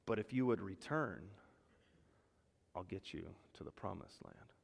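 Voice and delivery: very soft and low; tender promise. Acted. God promising us the promised land, hushed. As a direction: promise it gently, almost hushed. God's voice gently reassuring us.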